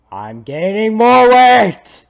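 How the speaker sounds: slow, high, drawn-out yell; mock-panicked shout. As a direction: wail it out in mock alarm. Mock panic while playing game.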